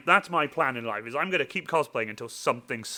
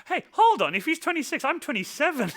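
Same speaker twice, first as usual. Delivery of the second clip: much higher, playful protest; mock indignation; 13 semitones higher than usual. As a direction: squeal a fake objection. Mock outrage at age joke.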